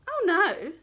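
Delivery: high, loud snooty oh no; the prince rejects the wolf; haughty refusal. Acted. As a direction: sniff in disdain.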